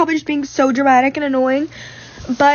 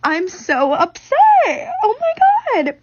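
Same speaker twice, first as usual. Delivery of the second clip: tearful upset; 10 semitones higher, much slower than usual. Real, not acted. Venting about her commute, voice cracking.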